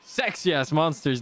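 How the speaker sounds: ordinary talk, nothing marked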